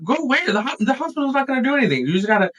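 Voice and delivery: high, fast imitated wife in a panic; mama bear panic. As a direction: snap back in a high-pitched mock panic. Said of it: "mama bear panic side".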